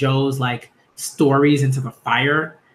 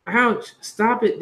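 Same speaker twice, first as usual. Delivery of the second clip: higher-pitched ouch and scolding; acted pained protest; 7 semitones higher, slower than usual. Character telling Daisy the goat to stop, high pained protest. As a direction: yelp it lightly, pitched up.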